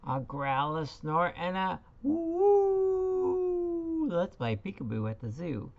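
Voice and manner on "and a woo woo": chimp hoot. Acted. Teacher previews animal sounds.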